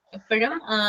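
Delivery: normal talking voice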